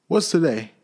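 high, loud call to the street; old man calling from the window. Acted. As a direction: call down to a boy below. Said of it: "Scrooge cried".

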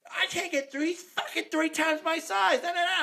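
high-pitched, loud mock complaint; mocking imitation. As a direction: mimic her in a high, loud squawk. Mocking retelling of woman's complaint.

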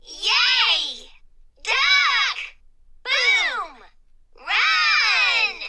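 high, loud, separate bursts; excited exclamations. A narrator's reading. Exclamation Point stirring up the classroom, high excited shouts. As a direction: shout each word as its own explosion. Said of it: "creating a great deal of excitement".

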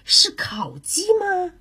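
eagerly drawn-out question with a lift in pitch; hopeful, slow eager question. Acted. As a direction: ask like a keen, hungry coyote. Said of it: "土狼热切地说".

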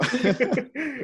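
a very high mock villain cackle; imitated Jabba laugh. Retelling the movie to friends, a high mock villain cackle. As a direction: cackle like the slug crime boss gloating. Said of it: "se ríe el man ahí como".

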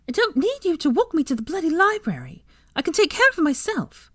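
high-pitched exasperated outburst; indignant. A narrator's reading. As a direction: refuse help in an indignant high voice. Irritated girl snaps at friends.

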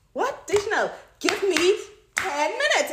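high, slow, emphatic plea; exasperated husband. Acted. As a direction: drag out the request in exasperation. Husband interrupted, acted exasperated.

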